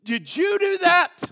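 loud, high, slow accusation; angry demand. Retold or imitated. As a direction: confront the boy as a furious father. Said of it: "He knows his dad is angry".

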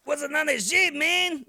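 hammed-up Mexican guy asking loud; Mexican accent. Host mimics confused overseas fan, loud Mexican accent. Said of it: "borderline offensive Mexican".

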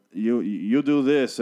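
slow and drawn out; slow, solemn mimicry; slow mock quote. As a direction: drag it out like a portentous line.

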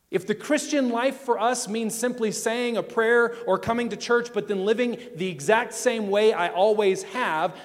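loud, driving pulpit delivery; rising sermon intensity; preaching at full force. Acted. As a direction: preach it hard to the congregation.

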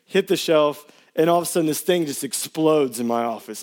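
ordinary talk

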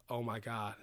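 quietly retold dismay, softly spoken; understated, hushed reaction to bad news; a little emotional. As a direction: let it drop softly, mildly moved. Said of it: "he kinda got a little emotional".

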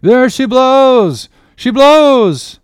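high, full-force drawn-out lookout's shout; bellowed whale sighting, each cry stretched; masthead cry. A narrator's reading. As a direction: call it out long and loud to the whole ship. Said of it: "was now the masthead cry".

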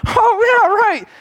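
high, mock sheepish realization; a hammed-up "oh, right" from the disciples. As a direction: play the embarrassed disciples catching on. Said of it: "slapped their head ... and said".

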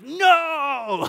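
high, loud, long drawn-out no; comic over-the-top refusal; mock outraged yell. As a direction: wail it in exaggerated protest. Acted refusal to a telemarketer.

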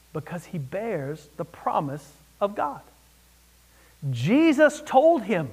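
normal talking voice

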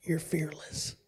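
soft unvoiced whisper into the ear; a father's whispered secret. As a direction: lean in close and breathe it. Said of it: "whispers in ear".

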